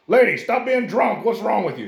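put-on high, loud, scolding old priest. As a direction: snap at her like a clueless priest. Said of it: "walks by and says".

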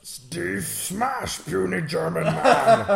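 slow loud Hulk-style boast. Acted. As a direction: smash it out like the Hulk. Hulk parody line.

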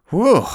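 high, loud mock sigh of relief; relieved whew. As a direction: blow out a big relieved whew for the kids. Relief that they won't die.

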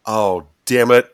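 real frustrated outburst; frustrated curse. Real, not acted. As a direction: curse as the answer slips away. Stuck on the answer.

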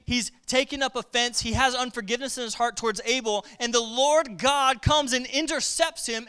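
ordinary talk

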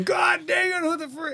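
high, far-off furious shout; mock scream. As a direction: yell in outrage from the bathroom. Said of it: "you hear him scream".

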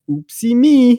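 high, loud cutesy oops; mock innocence. Shopper feigning a mistake at self-checkout, mock innocence.

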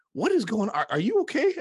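high, loud, startled cry of concern; alarmed wife. Retold or imitated. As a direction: burst in like a worried wife checking on you. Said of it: "She comes running in like".

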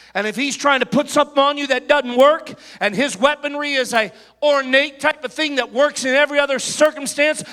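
fiery sermon delivery; preaching. Acted. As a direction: preach it loud and strong. Preaching at full force.

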